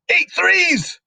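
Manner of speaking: very high, loud drilling; furious shout. A narrator's reading. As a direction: bellow the sums at a terrified pupil. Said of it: "the Trunchbull shouted".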